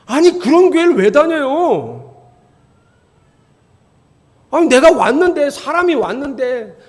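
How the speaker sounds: loud, pressing each phrase; indignant preaching. Acted. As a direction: demand why anyone stays.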